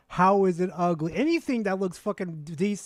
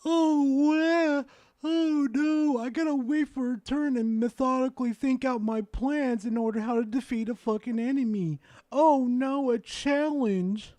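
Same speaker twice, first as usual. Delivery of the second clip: sarcastic; 6 semitones higher than usual. Acted. Mocking the turn-based complaint.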